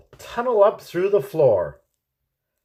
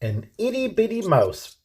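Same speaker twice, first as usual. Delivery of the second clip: ordinary delivery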